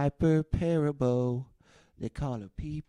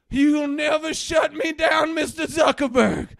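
high, loud mock-outraged yell; defiant shout; 8 dB louder than usual. Ranter warns Mister Zuckerberg on a livestream, defiant high shout. Defying Facebook in mock rant.

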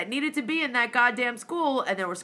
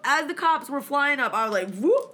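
usual speaking voice

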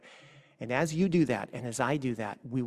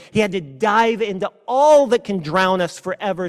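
loud, higher, rising fervour; preaching at full force; 5 semitones higher, 7 dB louder than usual. Acted. Pastor to the congregation on Jesus diving into death, fervent preaching. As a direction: drive each line home from the pulpit.

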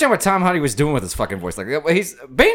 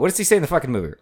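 normal talking voice

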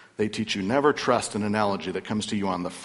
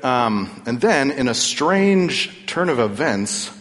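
ordinary talk, nothing marked

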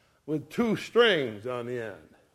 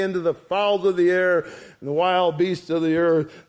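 raised, bold declaiming voice; preaching scripture; 3 semitones higher, 5 dB louder than usual. Acted. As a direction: proclaim it to the giant. David's proclamation read aloud.

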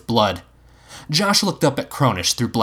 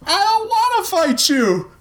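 high, strained echoing shout; high-pitched yelled protest. A narrator's reading. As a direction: throw it back as a high yell. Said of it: "Future Man repeated, also shouting".

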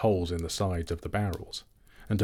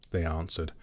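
plain reading voice

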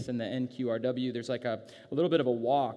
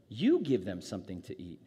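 YOU pitched up sharply, then falling away; stress on YOU; 4 semitones lower than usual. Shown as a demonstration. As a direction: hand it back with a punched YOU. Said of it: "with the sort of same emphasis".

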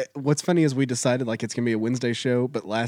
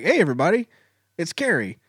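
high, upbeat video host intro; YouTuber voice; 6 semitones higher, 7 dB louder than usual. Pretend. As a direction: open your channel like a peppy host. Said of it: "that's gonna be my YouTube channel".

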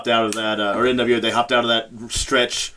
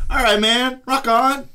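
much higher-pitched mock-casual sign-off; awkward retold exit; 12 semitones higher, slower than usual. Backing out of a hotel room party, high and awkward. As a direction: pitch it way up, imitating an awkward goodbye.

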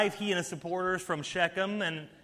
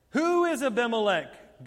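a raised mock shout, read from scripture; shouted question; 5 semitones higher, 5 dB louder than usual. A narrator's reading. Preacher reading Gaal shouting at the festival crowd, raised voice. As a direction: call it out like a rabble-rouser. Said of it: "Gaal shouted".

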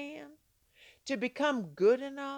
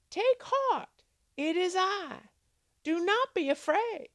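Jesus reassuring; 6 semitones higher than usual. Acted. Jesus voiced reassuring the disciples.

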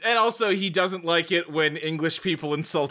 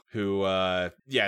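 ordinary talk

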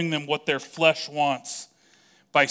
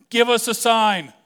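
higher, louder demanding voice; mock the crowd's insistent demand; a pushy crowd wanting proof, said higher and louder; 9 semitones higher, 8 dB louder than usual.

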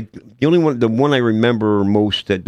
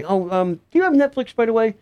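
put-on character voice; 13 semitones higher than usual. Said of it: "and I'll say".

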